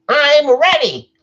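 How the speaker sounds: very high, loud cartoon squeal; SpongeBob. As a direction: belt it out slowly in a piercing cartoon voice. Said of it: "do all three SpongeBob".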